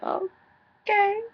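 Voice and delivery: high, drawn-out okay of disbelief; shocked okay. Retold or imitated. Vlogger retelling the rapper's reaction to being turned down, shocked. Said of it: "he was shocked, actually".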